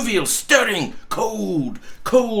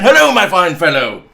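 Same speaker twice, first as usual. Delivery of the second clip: Scrooge louder and fast; calling out; 9 dB louder, faster than usual. Acted. Scrooge to the boy below, calling louder. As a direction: hail the boy cheerfully. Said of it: "calling downward to a boy".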